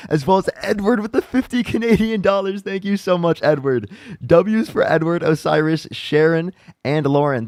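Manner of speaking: loud, high-pitched hype; excited donor shout-out. Real, not acted. Streamer thanking Edward for fifty Canadian dollars, excited.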